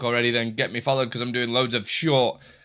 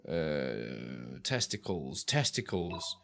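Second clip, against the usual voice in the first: silly deep voice; 9 semitones lower, 11 dB quieter, much slower than usual. Pretend. Silly word while working.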